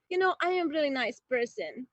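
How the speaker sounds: normal talking voice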